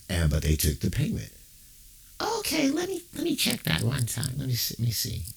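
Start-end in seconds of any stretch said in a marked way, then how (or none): 2.4-4.3 customer service rep. Acted.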